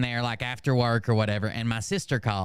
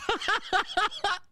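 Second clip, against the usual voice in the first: real squealing laugh, much higher; high burst of laughter. Real, not acted. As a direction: break up laughing. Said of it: "I was laughing so hard".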